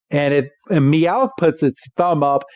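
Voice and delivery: ordinary delivery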